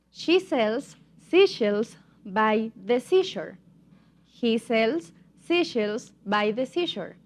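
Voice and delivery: slow, spaced-out tongue twister; slow demonstration. Shown as a demonstration. Teacher models a tongue twister for English learners, slowly. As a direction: say it slowly, word group by word group. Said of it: "but now a little bit faster".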